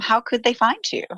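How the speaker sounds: ordinary delivery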